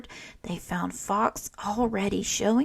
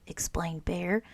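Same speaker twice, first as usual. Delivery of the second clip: usual speaking voice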